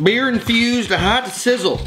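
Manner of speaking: hyped product name. Pretend. High slow hyped product name.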